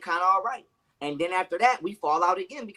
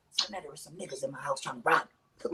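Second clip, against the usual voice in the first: mock whisper; 3 semitones lower, 7 dB quieter than usual. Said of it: "Like she whispering".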